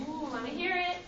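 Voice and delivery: ordinary delivery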